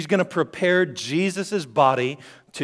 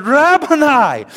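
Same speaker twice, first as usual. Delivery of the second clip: loud, high, slow cry of recognition; joyful cry; 13 semitones higher, 12 dB louder, slower than usual. Acted. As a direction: call out to a beloved teacher in joy. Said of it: "she cried out in, in joy".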